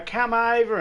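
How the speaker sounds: high, loud mock call; drunk Aussie invite. Drunk calling a lone tourist over, high loud voice. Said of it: "we'll go".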